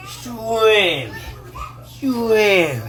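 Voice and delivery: Swimming drawn out, laughing; stress on swimming. Shown as a demonstration. Telling his men about sperm count, stretching Swimming out with a laugh. Repeating the word for emphasis.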